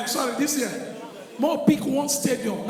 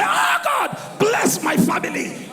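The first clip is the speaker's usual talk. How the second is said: high wailed opening, slow stretched plea; hammed-up desperate prayer; fervent praying pastor; 7 semitones higher, slower than usual. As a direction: cry out to God, starting very high. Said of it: "You're going to pray".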